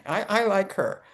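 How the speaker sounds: usual speaking voice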